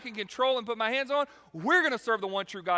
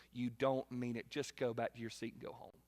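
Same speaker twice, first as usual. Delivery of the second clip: lower, softer rebuke; quiet rebuke; 9 semitones lower, 10 dB quieter than usual. Retold or imitated. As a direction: send them home low and calm. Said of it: "and he says".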